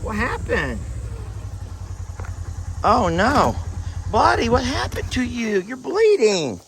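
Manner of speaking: high-pitched cooing to a hurt animal; worried pet talk. Real, not acted. Farmer to his injured goat on finding him bleeding, worried cooing. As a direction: coo with concern, pitched well up.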